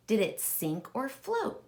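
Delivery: normal talking voice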